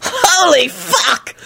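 slow laughing oath. A narrator's reading. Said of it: "Lupin laughed".

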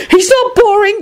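high, insistent schoolgirl voice; knowing hiss. A narrator's reading. Girl correcting her neighbour's gossip, knowing. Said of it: "hissed knowingly".

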